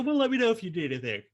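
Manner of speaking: bright helpful push, reliving the moment; a retold, keen let-me-help voice; eager offer of help.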